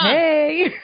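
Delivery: loud, high excited greeting; big hey. Real, not acted. Co-host jumping in to greet the host, loud and high. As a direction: burst in with a big cheerful hey. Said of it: "I'm not gonna let that hey be my trademark".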